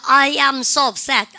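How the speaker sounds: I am so upset said slowly with so stretched; slow model phrase. Shown as a demonstration. Teacher modeling for students, slow and emphatic. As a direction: draw out "so" for the class. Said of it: "그런데 여러분들".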